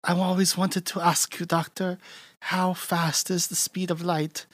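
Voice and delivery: high, soft voice of an admiring assistant; Terry Garr. Retold or imitated. Assistant puts a question to her doctor, high soft imitation. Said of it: "Terry Garr's like".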